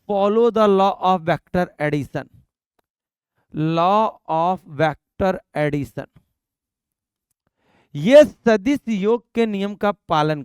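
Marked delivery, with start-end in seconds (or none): 3.5-6.1 very slow, word-by-word dictation; drawn-out teacher's emphasis; slow, deliberate repeat. Real, not acted. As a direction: spell out the key term unhurriedly, each word stretched. Repeats key term slowly for students.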